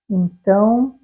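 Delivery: ordinary delivery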